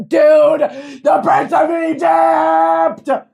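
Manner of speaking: loud, drawn-out, high shout; excited shout. Real, not acted. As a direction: yell it out in delight. Excited shout of song title.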